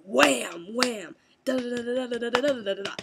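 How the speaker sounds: loud imitated wooden-block whams into rapid rattle; booming mimicked clacks speeding into a drumroll; purposefully loud block slaps. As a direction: slam out two whams, then rattle fast. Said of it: "purposefully loud".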